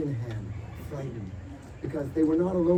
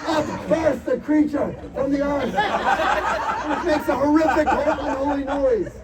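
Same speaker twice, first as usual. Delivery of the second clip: excited narration; 9 semitones higher, 5 dB louder than usual. A narrator's reading. GM narrating monster's emergence.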